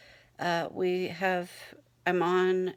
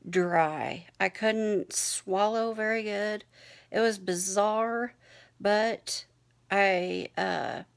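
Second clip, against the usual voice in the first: ordinary delivery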